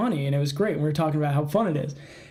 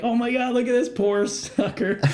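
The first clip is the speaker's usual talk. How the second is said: laughing; 6 semitones higher than usual. Retold or imitated. Mocking files with friend.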